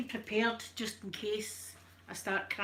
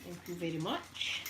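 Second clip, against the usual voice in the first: usual speaking voice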